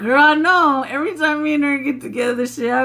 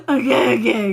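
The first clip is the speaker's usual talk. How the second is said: ordinary talk, nothing marked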